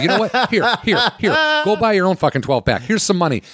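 high, laughing, shoving money at him; laughing retort. Retold or imitated. Son mocking his dad with money, laughing high. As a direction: laugh it out while offering cash. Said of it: "And I go".

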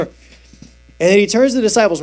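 normal talking voice

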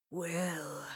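Robert speaking low to Will. A narrator's reading. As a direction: say his name low and secretive. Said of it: "said in a low voice".

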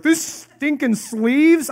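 high, loud, drawn-out comic outburst; exaggerated yell of frustration, dragged out; mock exasperation. Acting a brawler hampered by sleeves, mock exasperated shout.

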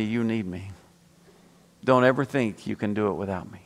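low, softened, measured voice of God; voice of the Lord. Retold or imitated. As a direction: answer low and quietly firm, as God would. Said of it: "you know what the Lord told me?".

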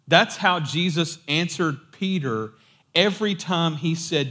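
ordinary talk, nothing marked

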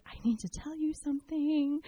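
tentative confession. Retold or imitated. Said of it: "I was like".

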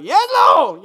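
loud, high cry of praise; fired-up shout. Retold or imitated. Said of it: "I feel like saying something like".

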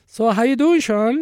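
high, loud chipper manager; breezy boss checking in; cheery boss. Retold or imitated. Boss voiced in retold story.

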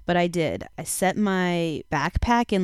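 normal talking voice

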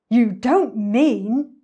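loud, very slow disbelief; aghast, stretched-out storybook reaction; dawning horror. Acted. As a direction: draw it out, appalled at the realisation. Hippo realises the crocodile's horrid plan.

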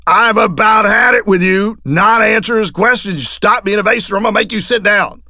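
high, loud yell; old judge screaming at the city manager. Retold or imitated. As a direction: tear into the witness. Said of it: "getting screamed at".

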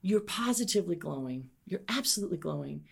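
softly, leaning in close, not a true whisper; hushed compliment for her ear only; quiet mock whisper; retold whisper. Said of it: "leaned in and whispered to her".

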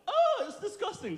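very high, comic squeal of revulsion; exaggerated high-pitched disgust; mock disgust. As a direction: recoil in hammed-up horror. Acted recoil at what is underneath.

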